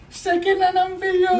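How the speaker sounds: laughing. Real, not acted. [name] laughs at the second pillow.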